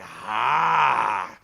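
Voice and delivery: low, loud wordless roar; ghostly warrior roar. Retold or imitated. A wrestler's ghost roaring back, mock roar. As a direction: bellow like a phantom. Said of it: "the Warrior's like".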